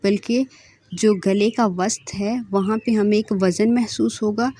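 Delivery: usual speaking voice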